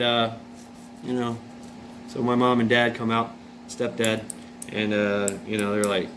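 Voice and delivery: ordinary delivery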